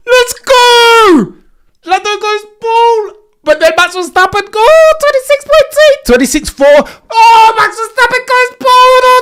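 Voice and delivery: high, loud, screaming with joy; yelling at the screen as pole flips; excited shouting. Real, not acted. Streamer screaming to chat as Lando takes pole, excited shout. Live pole lap reaction.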